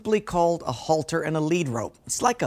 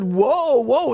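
panicked whoa; 8 semitones higher, 6 dB louder than usual. Retold or imitated. Rider on a bolting horse.

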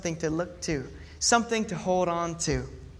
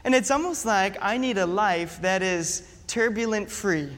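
ordinary delivery

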